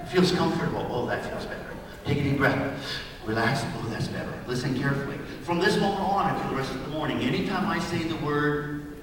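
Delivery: soft, soothing hypnotic murmur, some words breathed; hypnotist trance voice. Acted.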